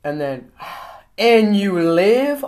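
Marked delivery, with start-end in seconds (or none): 1.2-2.5 slow drawn out. Pretend. Drawn out punchline.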